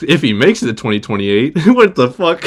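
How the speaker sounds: laughing. Real, not acted. Laughing at the joke.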